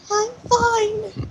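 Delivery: very high-pitched little voice; tiny high voice, putting on composure; shaken character voice. Acted. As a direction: squeak it out, shaken but insisting. Shaken, windswept Dora insists she's okay.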